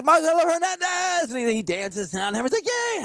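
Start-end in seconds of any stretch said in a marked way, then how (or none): 0.0-1.3 high, drawn announcer call; mock stage announcer. Imitates introduction of the headliner. 2.7-3.0 high, thrilled little cheer; excited child imitation. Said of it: "Henry's so excited".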